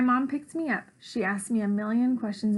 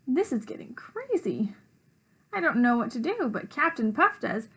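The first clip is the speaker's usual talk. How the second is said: plain reading voice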